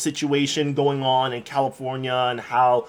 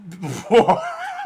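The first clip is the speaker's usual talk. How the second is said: high burst of real laughter. Real, not acted. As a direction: crack up laughing. Reacting to the proposal clip.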